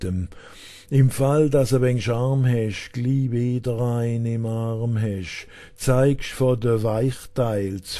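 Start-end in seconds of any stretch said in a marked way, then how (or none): none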